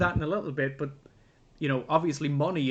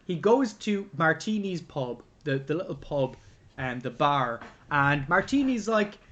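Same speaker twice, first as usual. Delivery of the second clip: ordinary talk, nothing marked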